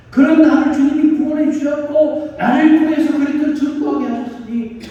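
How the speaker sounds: high, loud, driving sermon delivery; fervent preaching. Acted. Preacher tells of Paul's gratitude for salvation, fervent preaching. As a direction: proclaim it as overflowing gratitude.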